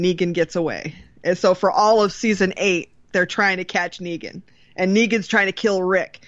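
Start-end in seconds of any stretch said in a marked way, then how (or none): none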